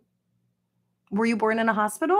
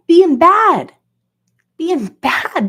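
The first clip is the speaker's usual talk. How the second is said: mock emphasis; 6 semitones higher, 9 dB louder, slower than usual. Loud mock emphasis with laugh.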